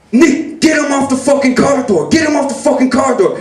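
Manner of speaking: high-pitched, frantic command; imitating a frantic brother calling out; retold yelled order. Brother ordering Nick to pull him off, frantic.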